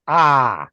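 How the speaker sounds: "ah" said as an open broad Boston ah; Boston pronunciation. Shown as a demonstration. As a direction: drop the r, say "ah" with an open ah. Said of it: "I will tell you in Boston, is pronounced".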